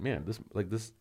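holding back a sneeze; talking through a sneeze coming on; about to sneeze. Real, not acted. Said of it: "I'm gonna sneeze again".

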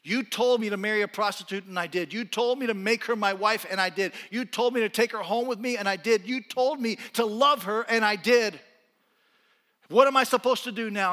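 loud, high anguished pleading; crying out to God. Acted. Hosea crying out loudly to God over his wife. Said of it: "he starts crying out to God".

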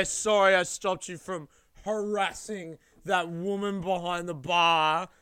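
put-on sarcastic drunk retort.

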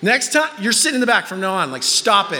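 loud, high start, quick telling-off; scolding parent. Retold or imitated. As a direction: lay down the law to the kid in alarm. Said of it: "and I'm like".

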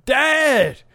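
high, slow whining son; whiny kid voice. Retold or imitated. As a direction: nag dad like a little kid.

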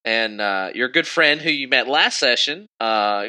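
normal talking voice

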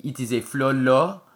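put-on English with a French accent; French-accented English, rising at the end. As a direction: put on a French accent, stretching the final word up. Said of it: "speak to him in a French accent in English".

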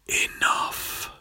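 breathy unvoiced whisper. A narrator's reading. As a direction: barely breathe the word.

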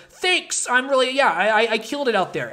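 hammed-up high gloating voice; smug Edison. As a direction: take credit like a giddy show-off. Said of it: "And Edison goes".

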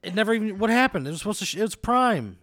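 loud, high-pitched protest; exasperated, voice shooting up; indignant outburst. Real, not acted. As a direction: protest loudly in disbelief. Outraged that the wallet never came.

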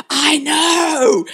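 high, hammed-up wail of agreement; mock exasperated cry. Mother egging on her son's tantrum, mock exasperated cry. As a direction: agree as if throwing a tantrum together.

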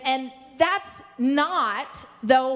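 ordinary talk, nothing marked

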